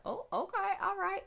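high, bright squeal of surprise; mock delighted surprise. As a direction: play it like pleasantly surprised news. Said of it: "I'm like".

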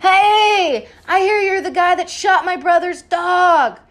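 loud taunting call. Retold or imitated. Kendall calls out to the bartender, loud and high. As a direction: taunt the guy loudly, high and bright. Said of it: "he's like".

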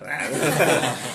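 big real laugh; loud laugh. Real, not acted. Laughing at own joke.

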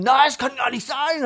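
loud, high indignant shout of denial; shouting back. Retold or imitated. Husband shouts back at wife, loud high denial. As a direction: yell back, denying everything. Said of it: "mein Vater hat natürlich zurückgeschrien".

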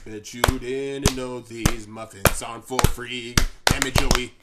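loud, slow booming announcement; hammed-up shouted promo; loud mock announcer. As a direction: boom it out like a trailer voice. Mock announcer after jingle.

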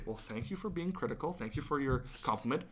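softly, with fake politeness; mock polite. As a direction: sweetly brush off the critic. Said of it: "you basically say".

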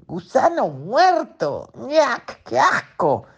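high, slow yuck voice; high disgusted otters. Acted. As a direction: squeal it in disgust like baby otters. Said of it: "dijeron las nutrias".

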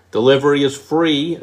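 free said loud and high; free, punched like an ad; stress on free. Shown as a demonstration. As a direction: sell the word free. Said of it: "the most powerful word".

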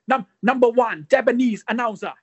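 hammed-up Japanese announcer accent, drawn out. Imitating Funaki's Japanese accent for his co-host. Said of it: "สำเนียงประมาณฟูนากิอะครับ".

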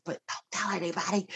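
压低声音快速说; 悄悄说秘密; whispering. Acted. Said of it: "I have to whisper this".

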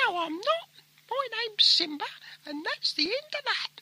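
very high, squeaky put-on pet voice; Simba. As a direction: pipe up as a talking dog. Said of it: "Oh, Simba, you are so silly".

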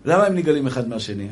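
ordinary talk, nothing marked

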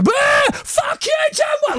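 very high, strained mock crowd shout; mock heckler's screech; imitating booing crowd. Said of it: "heard four hundred people boo".

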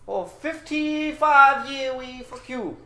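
put-on high, drawn-out Indian-accented threat. Scammer threatening fifty-five years of trouble, Indian accent. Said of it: "get my Indian and Australian".

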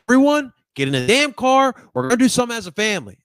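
loud, high bossy dad bellowing; dad yelling. Retold or imitated. Dad orders the family into the car, loud bossy shout. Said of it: "he was that type of dude that was like".

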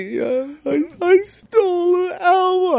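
high, slow, tearful wail; boy confessing to his mom, crying; crying confession. Retold or imitated. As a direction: sob out the confession. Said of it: "I cried".

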